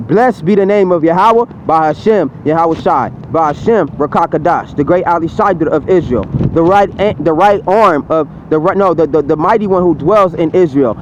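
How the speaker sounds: low, hushed litany of God's names; reverent recitation. Acted. Preacher reciting God's names to the congregation, hushed and low.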